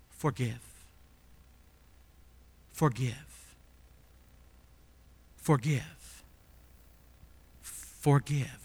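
quiet, slow, each Forgive spaced apart; soft repeated command. Acted. Preacher echoing Jesus' command to the church, soft and spaced. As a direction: let each one land softly.

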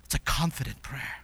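low, near-whispered reflection; hushed affirmation. Real, not acted. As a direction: say it softly, almost under the breath. Hushed repeat of earlier proclamation.

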